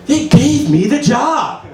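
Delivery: high, slow, drawn-out delight; excited good news. Acted. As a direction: announce it proudly to Grandma. Same good news again to grandma.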